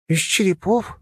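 puzzled rising question; surprised. A narrator's reading. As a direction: kids echo in surprise. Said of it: "в один голос удивились". (удивлённо переспрашивая)

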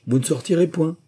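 high, firm exclamation; crying out. A narrator's reading. Claudine refusing to free the wind, crying out. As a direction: cry out a refusal. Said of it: "s'écria Claudine".

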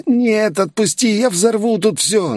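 high, desperate cry; frantic villain, pitched far up; panicked plea. Acted. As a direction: beg and threaten in a high, pained voice. Villain in agony threatens, pleads.

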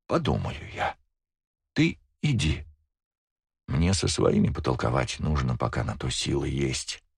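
put-on weak old woman's voice; a frail grandmother, fading; very old faint voice. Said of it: "Пробормотала быстро".

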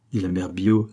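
ordinary delivery

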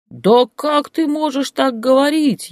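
very high, loud, slow indignant cry; outraged cry. Acted. Grandmother cries out at Boris, very high and loud. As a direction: cry out in shock at him.

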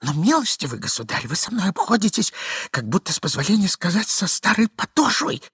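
high, pleading, plaintive voice; timid, wounded entreaty; meek despair. A narrator's reading. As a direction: beseech him meekly, voice rising. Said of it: "в припадке самого кроткого отчаяния".

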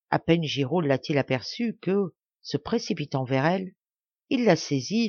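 usual speaking voice

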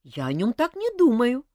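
offended. A narrator's reading. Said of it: "обиделась Ольга".

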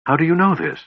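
high, startled question; astonished, pitched well up; gasped. A narrator's reading. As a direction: gasp it out in shock. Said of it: "Tanis gasped".

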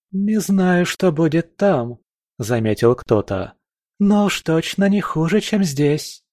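a hint of a strange monster; voiced minor monster. A narrator's reading. Unnamed monster speaks. (слегка изменённый голос монстра)

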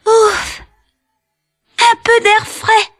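high little voice, relieved; relieved tiny boy. A narrator's reading. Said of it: "fit Tom Pouce".

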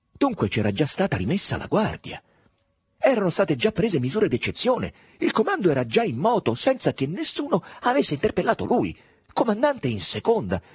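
pitched high and loud with mounting indignation; rising bitter anger. A narrator's reading. Drogo fuming at being bypassed by his officers, bitter rising anger. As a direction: let the outrage climb. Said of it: "un'ira cocente ed amara".

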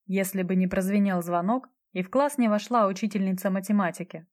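usual speaking voice

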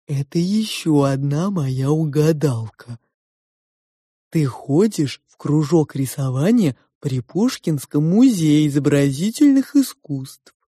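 slow, honeyed sow; tender-voiced pig. A narrator's reading. The pig praises the girl's drawing, tenderly. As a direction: speak fondly, drawing out the vowels.

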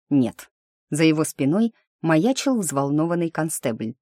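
usual speaking voice

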